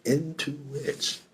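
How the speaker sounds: slow, softly drawn-out quizzical echo; hushed, puzzled mimicry; teasing echo. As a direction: repeat it back slowly, savoring the odd phrase. Mock-echoes the other speaker's odd phrase.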